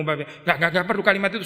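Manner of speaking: normal talking voice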